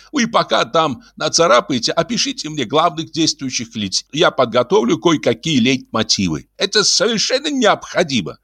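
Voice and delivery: high-pitched composer giving orders; high theatrical character voice; Ibrahim's insistent instructions. Acted. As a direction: hand out tasks in a high, insistent voice.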